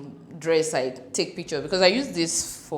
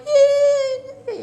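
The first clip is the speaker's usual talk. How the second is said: shrieked high and loud yay; a big squeal of joy, much higher and louder; retold cheer; 9 dB louder than usual. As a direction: replay the delighted shriek at the gift. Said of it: "I was like".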